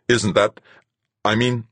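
tag. Acted. Flustered in quarrel.

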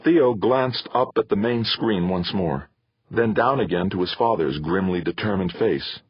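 usual speaking voice

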